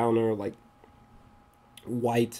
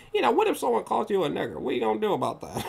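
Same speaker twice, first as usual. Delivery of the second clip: higher, fast mock quote of the officer; imitating the officer again; 9 semitones higher, faster than usual. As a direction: rattle off the officer's question in a raised voice. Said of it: "when he was like".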